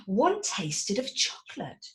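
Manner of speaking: plain reading voice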